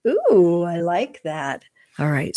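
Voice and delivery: normal talking voice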